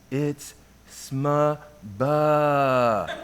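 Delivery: very slow, drawn-out "sma ba"; slowly. Retold or imitated. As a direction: stretch each syllable, holding the final "ba".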